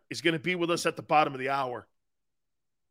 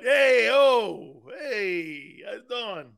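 put-on South Philly accent; 4 semitones higher, much slower than usual. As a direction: call out long South Philly greetings, then ease off. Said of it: "That's how we talk in South Philly".

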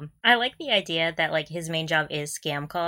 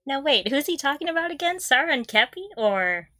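mock confusion; 7 semitones higher than usual. Teasing the listeners about the quote, mock confusion. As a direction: pretend puzzlement, voice pitched higher. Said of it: "feigned confusion".

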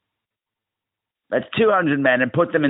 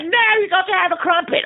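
high mock insistence. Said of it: "They're like".

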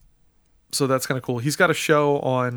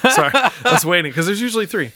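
laughing; 8 semitones higher, 6 dB louder, faster than usual. Real, not acted. Laughs at failed sneeze.

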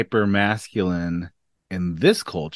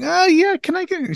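very high, louder timid ordering voice; voice gone up; 4 dB louder than usual. Retold or imitated. Customer ordering from a Boston bartender, voice gone up high. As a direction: send your own voice way up, imitating yourself. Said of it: "my voice went up".

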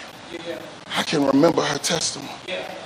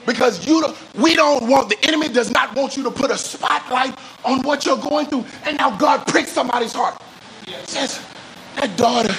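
high, urgent preacher's pitch; fervent preaching; 7 semitones higher than usual. Real, not acted. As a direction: press the point hard from the pulpit, voice raised high. Preaching at full force.

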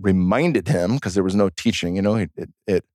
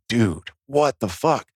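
stunned; much slower than usual. Retold or imitated. Retelling stunned reaction to second hummingbird.

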